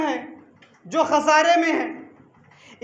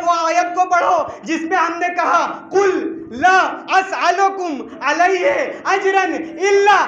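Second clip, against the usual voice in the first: raised, ringing sermon climax; preaching at full force; 3 semitones higher, 3 dB louder than usual. Real, not acted. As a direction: build in pitch and volume toward the key verse. (पूरे जोश में ख़िताबत)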